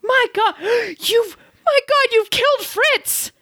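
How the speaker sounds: high gasping cry of horror; shocked discovery of a body; wife finding her husband dead, aghast; horrified shock. Acted.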